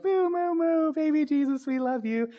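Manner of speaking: put-on very high, loud child voice; little girl voice. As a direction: squeal it like a small child. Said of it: "And she's like".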